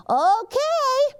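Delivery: high, anxious little truck voice; Gus voice. A narrator's reading. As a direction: squeak it out nervously as little Gus. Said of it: "says Gus".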